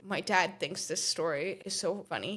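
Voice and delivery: usual speaking voice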